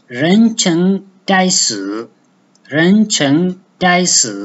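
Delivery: "renceng daisu" with careful Chinese tones, slow; slow tone demo of "renceng daisu". Shown as a demonstration. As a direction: say "renceng daisu" slowly, second, first, fourth tones. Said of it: "renceng contain the second tone".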